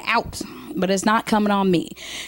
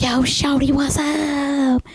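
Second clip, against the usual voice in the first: put-on higher, cocky teen pickup line; 5 semitones higher, much slower than usual. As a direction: slide up like a seventeen-year-old. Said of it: "talking about".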